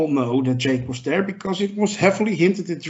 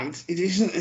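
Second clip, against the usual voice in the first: usual speaking voice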